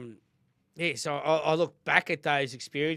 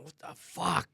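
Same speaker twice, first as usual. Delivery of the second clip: ordinary talk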